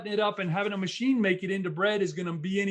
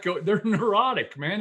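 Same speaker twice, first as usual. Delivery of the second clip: ordinary talk, nothing marked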